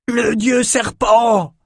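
high, slow bawled shout; bawling. A narrator's reading. Norokai warriors seeing their god, bawling shout. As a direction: roar it out in awe and terror. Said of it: "braillèrent les Norokai".

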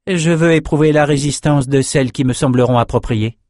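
stern sorceress. A narrator's reading. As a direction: state it as a decided magician.

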